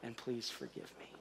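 low, softened apology, trailing off; humble apology. Acted. Parent apologizing to their kids, soft and humble. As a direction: parent telling kids "I'm sorry", humble and hushed. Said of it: "need to hear you say things like".

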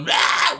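harsh, drawn-out cry; hammed-up yell of agony; mock scream. Villain with spiders eating his face, mock scream. Imitates villain being eaten.

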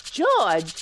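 a loud, sharp call of his name. Acted. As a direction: shout for him to answer. Wife calling husband again.

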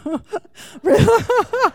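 high burst of laughter; bright real laugh after the tease. Real, not acted. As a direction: laugh out loud at the joke. Laughing at her own joke.

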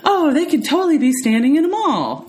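high, sing-song mock of a dismissive commenter; mocking quote. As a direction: parody the shallow comment in a put-on voice. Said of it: "these comments get made about".

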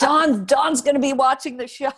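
high, loud and laughing through the words; laughing warning. Retold or imitated. Dancer phoning the dressing room about the boss, laughing and loud. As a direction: giggle out the secret alarm.

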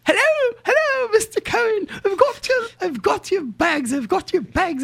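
very high, loud, elated put-on voice; hyped-up clerk. Clerk calls traveler about found bags, hyped-up high voice. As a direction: imitate an overexcited clerk shrieking down the phone. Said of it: "I like that accent you get".

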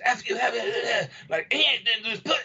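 hammed-up cursing fit; retold cussing. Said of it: "was swearing and cussing".

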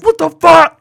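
mock outraged shout over the mic; enemy death yell. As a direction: blurt it like a killed player.

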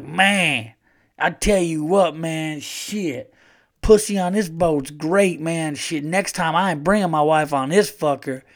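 southern trucker bragging loudly in the bathroom; southern drunk. Retold or imitated. Southern drunk in the bathroom announcing to everyone, loud southern accent. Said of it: "he had that southern accent".

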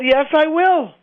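high, resolute declaration; emphatic assent. Retold or imitated. Retells his defiant answer to doubters.

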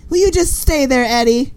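high pleading sitcom wife; 1950s housewife. Pretend. Wife voiced in 1950s sketch.

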